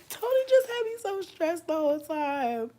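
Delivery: high-pitched exasperated wail; stressed-out whine. Real, not acted. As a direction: draw it out high, as if worn out by stress. Venting about being stressed.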